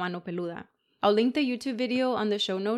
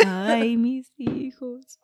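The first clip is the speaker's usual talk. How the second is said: loud, high, slow ghostly wail; weeping ghost woman; 3 semitones higher, 4 dB louder, much slower than usual. Acted. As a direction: commit fully to the mourning cry. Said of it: "You were like".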